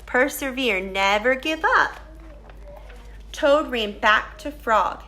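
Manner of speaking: usual speaking voice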